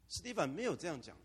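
ordinary talk

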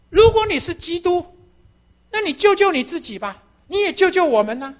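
sneering robber's dare; provoking taunt. Acted. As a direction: taunt the dying man to save himself. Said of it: "一个挑衅的声音".

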